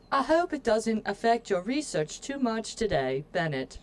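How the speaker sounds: put-on lively, British-accented letter reading; British manor servant. Staff writing to Lady Arabella on her return, playful British accent. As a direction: read it out as an English member of staff. Reads game letter in character.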